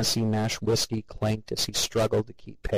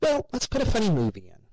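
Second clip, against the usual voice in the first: high, quick bubbly mother voice; cheery mother; 12 semitones higher, faster than usual. A narrator's reading. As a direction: rush on brightly to smooth things over.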